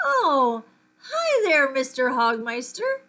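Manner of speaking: high, loud, flustered hello; nervous greeting. Acted. Boy caught spying greets the janitor, startled and high. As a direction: cover up being caught with a startled squeak.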